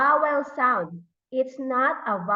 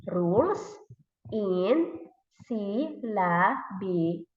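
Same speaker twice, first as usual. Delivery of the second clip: slow "syllabication", split sy-lla-bi-ca-tion; syllable by syllable; 3 semitones lower, slower than usual. Shown as a demonstration. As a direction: sound out each syllable of "syllabication" separately. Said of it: "why do we need to syllabize the word".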